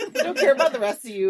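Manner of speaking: laughing, playfully blunt; laughing quote. Retold or imitated. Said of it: "I've had enough".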